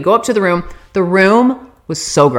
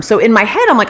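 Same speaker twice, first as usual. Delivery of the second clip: normal talking voice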